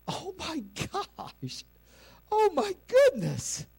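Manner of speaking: high, breathless gasp of wonder; awestruck. Retold or imitated. Disciples after the storm calms, high awestruck gasp. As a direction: gasp it out in awe. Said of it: "but in a sense of awe".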